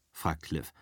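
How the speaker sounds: ordinary delivery, nothing marked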